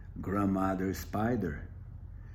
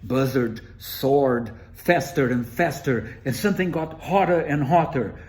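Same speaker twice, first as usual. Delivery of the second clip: faster and louder as the heat builds; building excitement; 2 semitones higher, 7 dB louder than usual. A narrator's reading. Narrator quickening as Buzzard soars faster.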